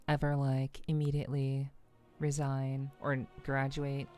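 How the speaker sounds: ordinary delivery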